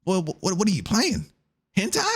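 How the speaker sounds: rising to a shocked mom squeal on Hentai; mock-horrified mom; mom's shocked voice. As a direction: play a horrified mother catching her son. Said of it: "She gonna tell me".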